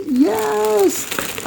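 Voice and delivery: high, excited squeal; delighted cheer. Real, not acted. Quilter thrilled at scraps for a block, high delighted cheer.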